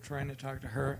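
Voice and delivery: ordinary delivery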